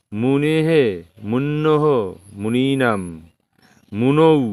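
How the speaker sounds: steady sing-song listing for students to repeat; Sanskrit declension recitation. Shown as a demonstration. Teacher drills Muni forms for the class, sing-song recitation.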